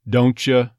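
"don't ya" run together as doncha; joined "don't ya". Shown as a demonstration. Coach shows casual linking, "don't ya" as doncha. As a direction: blend t and y into ch in "don't ya". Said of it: "did that joining together".

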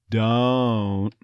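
"Don't" stretched, vowel gliding from schwa; slow "Don't" down to show the glide; drawn-out "Don't". Shown as a demonstration. Coach breaks down the vowel, long "Don't". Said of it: "Same thing on don't".

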